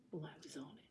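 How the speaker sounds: hushed whisper. Retold or imitated. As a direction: whisper it like a secret. Son whispering his reply.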